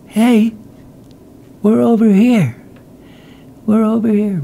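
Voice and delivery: high, thin call at no great volume; mock calling out. Lost survivors calling to searchers in the jungle, restrained high call.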